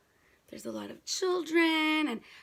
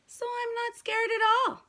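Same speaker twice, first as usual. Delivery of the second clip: put-on boastful kid voice; 9 semitones higher than usual. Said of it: "Jabari said".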